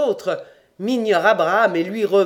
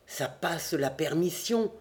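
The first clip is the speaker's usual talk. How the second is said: soft, baffled aside; murmured; 7 dB quieter than usual. A narrator's reading. Narrator murmurs to himself in disbelief, quietly. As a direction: murmur it to yourself. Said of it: "murmurai-je".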